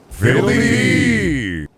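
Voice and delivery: loud, long, falling cry; catchphrase shout. Acted. Streamer delivering the promised big catchphrase, loud drawn-out shout. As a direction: belt it out slow and high. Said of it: "prepared for the four fiddle-dee-dees".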